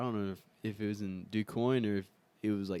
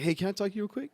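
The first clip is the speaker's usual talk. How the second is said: friendly dad; 11 semitones higher, 3 dB louder than usual. Retold or imitated. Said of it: "He's like".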